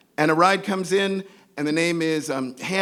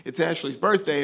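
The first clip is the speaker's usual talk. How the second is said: ordinary talk, nothing marked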